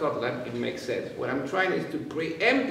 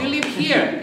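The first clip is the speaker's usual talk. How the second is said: usual speaking voice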